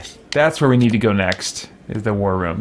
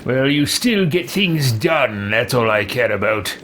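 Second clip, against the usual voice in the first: lightly voiced game hero. Player answering for their character to a grieving advisor, lightly voiced. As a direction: play the pragmatic boss, slightly hammed-up.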